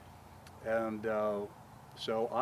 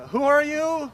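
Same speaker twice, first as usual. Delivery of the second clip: sharp, loud, high-pitched "who?"; abrasive retort; 14 dB louder than usual. Retold or imitated. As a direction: bark it back, high and loud, like a rude stranger. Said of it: "I was pretty abrasive".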